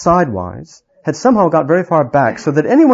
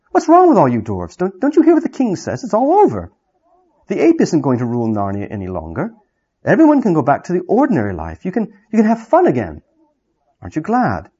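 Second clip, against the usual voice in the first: put-on much higher, pleading girl's voice; eager girl; 4 semitones higher than usual. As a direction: plead with them, bewildered and eager. Jill pleads with dwarves.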